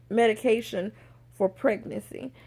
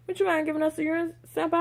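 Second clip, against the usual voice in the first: higher, brisk polite request; nicely worded request; 5 semitones higher, faster than usual. Retold or imitated. Medical worker asking patient for a urine sample, higher polite voice. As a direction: ask politely in one smooth go. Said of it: "trying to think of the nice way to say it".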